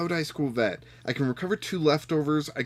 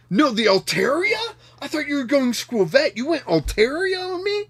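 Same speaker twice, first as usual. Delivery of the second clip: rising higher and higher in disbelief; shocked disbelief; 12 semitones higher, 5 dB louder than usual. Real, not acted. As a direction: pitch climbing with outrage. Shocked at opponent's choice.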